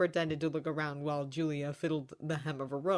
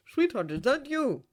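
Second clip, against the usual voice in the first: raised, amazed question; astonished; 8 semitones higher, 6 dB louder than usual. A narrator's reading. Father surprised to hear daughter, raised amazed voice. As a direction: ask as if you can't believe it. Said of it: "in astonishment".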